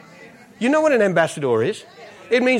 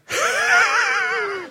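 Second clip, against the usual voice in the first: very high, thin held squeal; mock breathless squeak, held then falling; winded wheeze. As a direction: imitate someone badly winded, one long high wheeze. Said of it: "he's totally winded in his spirit".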